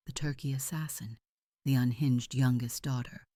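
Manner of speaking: plain reading voice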